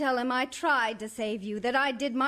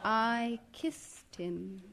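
quiet confession; 4 semitones lower, 7 dB quieter, much slower than usual. Acted. Wife confessing.

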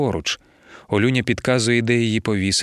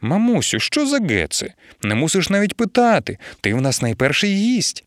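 a higher, chirpy wife's voice; cheeky young wife; 7 semitones higher than usual. Acted. Wife teasing her mother from under the blanket, chirpy. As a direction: tease your mother brightly from under the blanket. Olyunya chiming in from bed.

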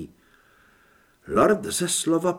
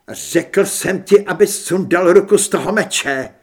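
loud, high, angry command; forceful order; 4 semitones higher, 9 dB louder than usual. A narrator's reading. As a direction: insist hard on being obeyed. Son repeats order to drop sword. (důrazný, rozzlobený rozkaz)

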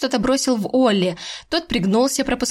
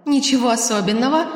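ordinary delivery

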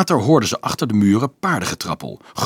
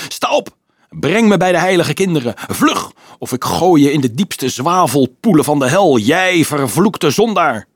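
high, louder devilish orders; commanding devil; 4 semitones higher, 4 dB louder than usual. Acted. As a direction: drag him up with threats. Devil orders fallen soldier. (hoog, luid bevelende duivel)

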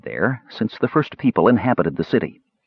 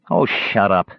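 ordinary delivery, nothing marked

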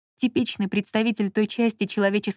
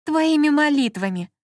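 a mild mock sneer through gritted teeth, higher; 6 semitones higher, 4 dB louder than usual. A narrator's reading. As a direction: spit it out reluctantly through the teeth. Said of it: "процедила женщина". (процедила сквозь зубы, выше)

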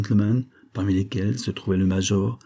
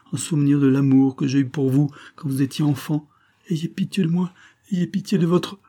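desperate pleading; 6 semitones higher than usual. A narrator's reading. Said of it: "d'une manière suppliante".

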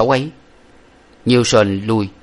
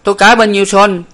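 much higher, louder call for bets; shouting a bet; 10 semitones higher, 6 dB louder than usual. A narrator's reading. A spectator shouts out a wager, high and loud. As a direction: yell across the room for takers. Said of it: "có một người la lên rằng".

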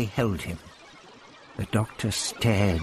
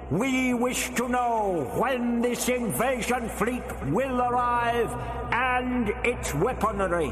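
much higher, slow, hollow anger; angry alien boom; 12 semitones higher, slower than usual. A narrator's reading. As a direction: demand answers, menacing. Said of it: "the voice boomed angrily back".

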